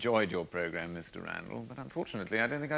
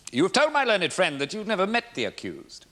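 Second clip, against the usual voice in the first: raised, louder accusing delivery; forceful challenge; 10 semitones higher, 7 dB louder than usual. Acted. Barrister confronting the witness with his denial, forceful and raised. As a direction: press the witness hard, voice lifted.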